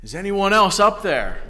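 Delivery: mock yell. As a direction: call out like Jack on the branch. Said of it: "we yell back".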